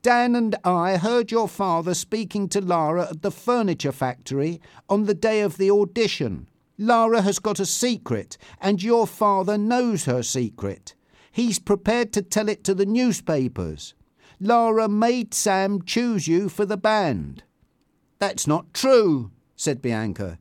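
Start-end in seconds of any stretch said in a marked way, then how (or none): 0.0-17.4 higher, emphatic explanation; insistent, slowing on the final point. A narrator's reading. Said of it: "insisted Tanya". 18.2-19.3 higher, slow emphatic denial; upset denial. A narrator's reading. Bianca denies the accusation.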